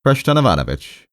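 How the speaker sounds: high-pitched, mildly irritated appeal; same aggrieved tone. Acted. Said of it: "in the same tone as before".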